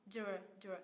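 "Dr" with the D drawn back into R; tongue pulled back. Shown as a demonstration. Teacher explaining tongue retraction, isolated "Dr" blend. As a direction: sound out "Dr" as a short isolated blend. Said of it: "đầu lưỡi của mình lại đằng sau".

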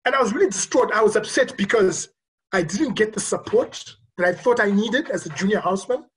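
ordinary talk

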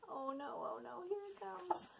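very soft dread; dreading whisper. Real, not acted. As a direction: hush it as if bracing. Dreading silly sounds.

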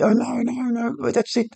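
dismayed groan. Real, not acted. Dismay at a match moment.

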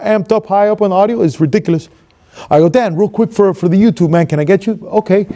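usual speaking voice

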